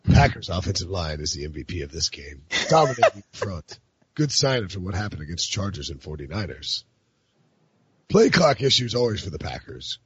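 deep, gruffy tweet recital; low spoken-word voice. Acted. Podcast host performs sportswriter's tweets for friends, low gruffy voice. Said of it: "Can I read some Prisco tweets in that voice?".

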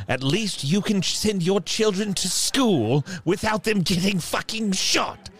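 pointed, high-pitched outburst; heated consolation. Acted. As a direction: build to an indignant high pitch. Character's ranting consolation to townsman.

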